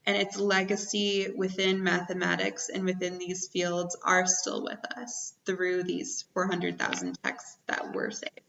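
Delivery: ordinary talk, nothing marked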